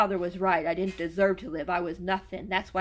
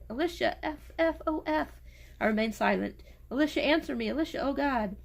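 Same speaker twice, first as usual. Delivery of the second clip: high, desperate calling; lightly voiced wailing husband; 6 semitones higher than usual. A narrator's reading. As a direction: call her name in anguish.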